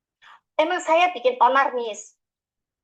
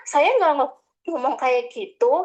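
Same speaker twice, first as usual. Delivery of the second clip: normal talking voice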